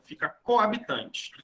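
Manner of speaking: ordinary talk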